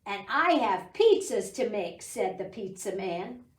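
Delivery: bright storybook reading voice; teacher reading a rainy-day book to preschoolers, storybook voice; reading to children. Acted. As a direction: read it like story time for little kids.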